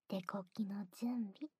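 hushed ASMR whisper; teasing ear whisper. Acted. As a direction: barely breathe the words.

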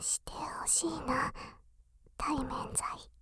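drawn-out pleading whisper; slow whisper. Acted. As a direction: whisper it slowly, coaxing. Intimate whispered talk.

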